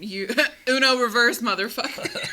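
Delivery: laughing retort. Acted. Acted spat retort.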